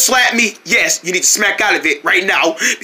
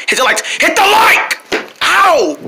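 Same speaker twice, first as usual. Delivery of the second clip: pleading shout; 10 semitones higher than usual. Acted. Begging viewers to like.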